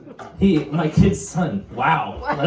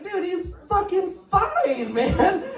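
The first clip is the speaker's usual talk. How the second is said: unbothered; 14 semitones higher, much slower than usual. Retold or imitated. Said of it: "And I'm like".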